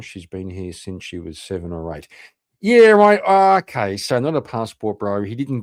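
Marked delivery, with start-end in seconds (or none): 2.6-3.2 sarcastic. Real, not acted. Sarcastic outburst at clip.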